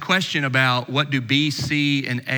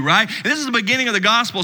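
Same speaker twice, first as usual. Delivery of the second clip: ordinary delivery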